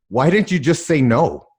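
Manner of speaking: put-on child's indignant question. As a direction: ask it like a baffled nine-year-old. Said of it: "I'm like".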